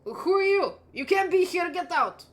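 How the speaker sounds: angry chef. Acted. Head chef yelling at intruders in his kitchen, angry. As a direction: chase them out of his kitchen. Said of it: "turns around and looks at you and goes like".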